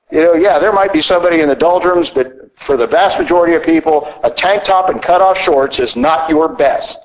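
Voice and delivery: usual speaking voice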